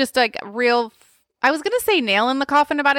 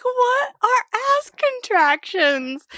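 high, slow, mock-confused question; hammed-up bewilderment; baffled question; 11 semitones higher, much slower than usual. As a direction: puzzle over the words like they make no sense. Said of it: "I was like".

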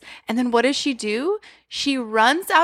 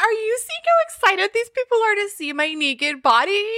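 high-pitched imitation of a thrilled girl; mock excited; 10 semitones higher than usual. Girl showing off to her driver, mock excited. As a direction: gush like the oblivious girl, voice way up.

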